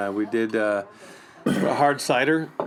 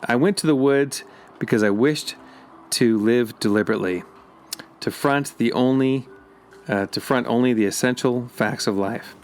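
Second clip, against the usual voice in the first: poem reading; 3 semitones lower, 4 dB louder than usual. Acted. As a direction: read it deliberately like a poem. Reads Thoreau quote aloud.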